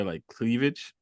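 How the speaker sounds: ordinary delivery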